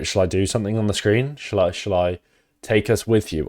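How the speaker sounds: ordinary talk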